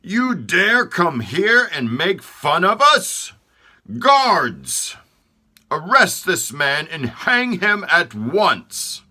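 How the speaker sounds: the king commanding guards to hang him; furious king. Acted.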